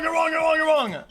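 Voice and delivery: ordinary delivery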